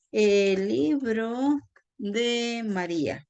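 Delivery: drawn-out words, slowly dictated; slow dictation. Shown as a demonstration. Teacher giving an example of the article, slow dictation. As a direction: spell out the sentence slowly, word by word.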